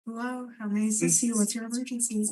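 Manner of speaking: soft, quick automated phone greeting; sweet recorded hold message. Acted. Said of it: "like, a automated message".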